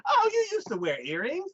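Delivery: very high mock surprise; imitated nosy suitor. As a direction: play a gushing, too-close date. Voices the suitor noticing earring holes.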